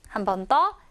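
ordinary talk

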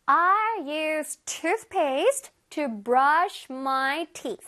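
very slow, word-by-word teacher's model; slow modelling. Shown as a demonstration. Teacher reads an example for children, very slowly. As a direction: stretch each word out clearly for learners.

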